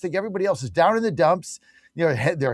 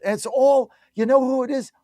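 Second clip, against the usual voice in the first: usual speaking voice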